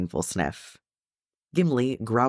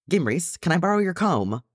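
pitched up high, calling across the house; holler; 9 semitones higher than usual. A narrator's reading. Gimli rummaging, hollering to his sister for her comb. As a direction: shout it through the rooms to her. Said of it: "he hollered".

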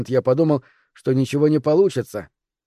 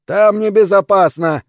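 slow, raised, insistent warning; stubborn insistence; 7 semitones higher, 7 dB louder than usual. A narrator's reading. Stubborn old man warning helpers off, loudly insistent. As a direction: insist loudly, dragging out the words. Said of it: "упорствовал старик".

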